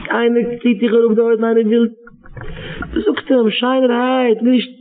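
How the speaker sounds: put-on preacher urging parents to save their children; impassioned preaching. Preaches again, rising count.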